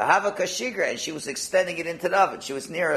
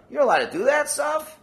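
much higher disbelieving question; incredulous; 12 semitones higher, 4 dB louder than usual. Acted. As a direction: challenge him in disbelief. Incredulous quote to angel.

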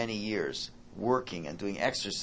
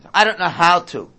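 put-on obnoxious child; 8 semitones higher, 16 dB louder than usual. Obnoxious kid voiced.

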